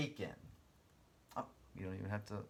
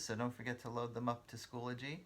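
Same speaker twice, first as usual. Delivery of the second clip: ordinary talk, nothing marked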